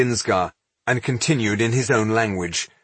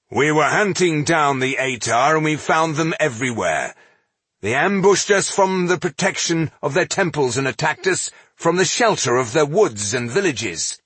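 higher, insistent self-justification; defiant dwarf leader; 7 semitones higher than usual. A narrator's reading. Dwarf defends his campaign to the accusing elf, defiant. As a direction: argue your case firmly.

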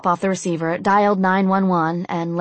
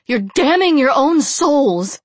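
slow, high, loud yell; 8 semitones higher, 6 dB louder, slower than usual. A narrator's reading. Blonde captive condemning her captors, yelling. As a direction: cry it out as a damning warning. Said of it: "yelled the blonde".

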